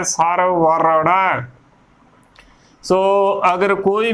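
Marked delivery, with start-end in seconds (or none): 0.0-1.4 ਸਰ ਵਰੜਾ slowly in two parts, r before ੜ; correct pronunciation. Shown as a demonstration. Teacher modelling the word for learners, slow correct ਸਰ ਵਰੜਾ. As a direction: draw out ਵਰੜਾ with a final long aa. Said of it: "ਸੋ ਏਦਾਂ ਉਚਾਰਨ ਕਰਨਾ ਹੈ".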